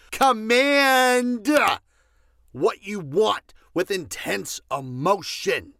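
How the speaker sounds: barked loud order; emphatic shout; loud command. Real, not acted. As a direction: snap it out loud. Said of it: "I know I'm getting a little loud".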